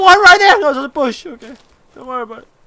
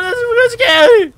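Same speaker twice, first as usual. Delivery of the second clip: louder, much higher mock-frightened cry; mock panic; 10 semitones higher, 5 dB louder than usual. Fretting that his friend leaves, a mock-scared cry. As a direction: squeal it like a scared kid. Panicked about going away.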